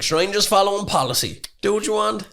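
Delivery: high, dismissive clerk voice. Retold or imitated. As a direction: shrug it off as just policy. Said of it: "He was like".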